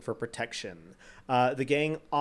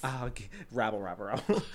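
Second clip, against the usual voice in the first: sputtering foiled villain; 4 semitones lower, 5 dB quieter than usual. Acted. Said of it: "Shakes fist wildly".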